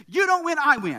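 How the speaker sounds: put-on high-pitched indignant kid; imitated childish defiance; enraged child. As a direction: declare it like a boy in a temper. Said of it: "Grady is just becoming enraged".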